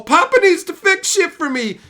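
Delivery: put-on high, loud babyish whine; mock childish whine. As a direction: whine like a spoiled kid. Mocking childish demand.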